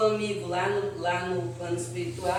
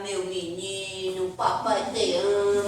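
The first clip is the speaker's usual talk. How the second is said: lightly voiced fond dad, slowly; doting father. Father coos to his baby son, mildly doting. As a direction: coo it like a proud father.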